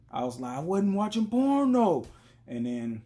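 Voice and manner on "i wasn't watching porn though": high, loud protest of innocence; exaggerated denial. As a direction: cry out the denial as retold. Loud denial in retold quarrel.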